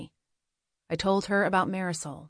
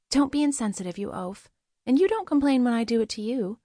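higher-pitched woman's voice, playfully chiding; higher character voice, teasing rebuke; Goldie teasingly scolding; 5 semitones higher than usual. Acted. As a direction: chide him in a lifted, lively voice. Goldie scolds Yarick in dialogue.

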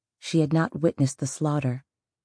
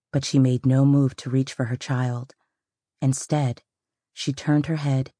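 plain reading voice

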